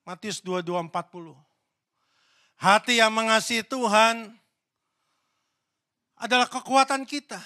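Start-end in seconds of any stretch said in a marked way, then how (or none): 2.6-7.4 preaching loudly. Acted. Preaching climax to congregation.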